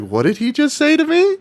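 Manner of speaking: high, stunned scammer voice, slowed at the end; hammed-up shocked scammer; mock scammer. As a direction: squeak out the scammer's disbelief. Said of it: "He was like".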